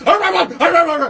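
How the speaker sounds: loud, high frantic barks; explosive mock dog barking; imitated dog barking. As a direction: bark like a police dog going ballistic. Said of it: "and the dog goes".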